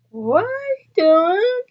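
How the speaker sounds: can't believe what he's doing; baffled villager. Retold or imitated. Villagers watching a stone go into the pot, astonished slow question.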